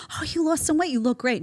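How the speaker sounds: high-pitched gushing praise, imitated; cooing mock compliment that eases down in pitch; acted-out compliment. Acts out old-time compliment.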